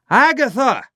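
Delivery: high, loud call of Agatha; shouting a name. A narrator's reading. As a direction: call into the locked vault. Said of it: "he shouted as loudly as he could".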